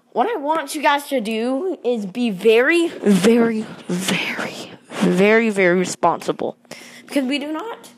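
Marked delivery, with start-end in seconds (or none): 2.9-5.5 whisper. Real, not acted. Urging buyers to be responsible.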